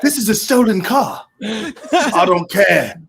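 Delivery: hammed-up British accent, acting out film dialogue. Reciting film dialogue to laughing friends, British accent. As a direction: play both sides in a British accent. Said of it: "I can't get away with the accent".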